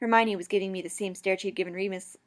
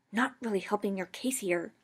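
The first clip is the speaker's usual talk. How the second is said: ordinary delivery, nothing marked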